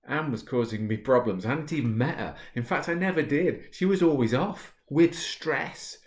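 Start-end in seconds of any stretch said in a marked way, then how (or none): none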